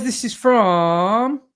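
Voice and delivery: drawn-out, slow stall on the last word; slow, trailing search for a name. Real, not acted. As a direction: stretch it out while hunting for the name. Stalling while looking for sender's name.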